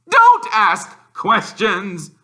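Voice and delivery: slow, loud, high clipped order; snapped. A narrator's reading. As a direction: bite off each word. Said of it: "snapped his uncle".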